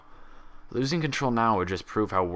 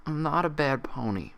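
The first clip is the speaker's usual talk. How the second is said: almost believing it; small tearful echo; 7 semitones higher than usual. Acted. Pinkie finally saying it back, small and teary.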